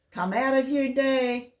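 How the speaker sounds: lightly voiced cat taunting the mice; taunting cat call. A narrator's reading. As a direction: dare the mice to come out. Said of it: "called Goliath".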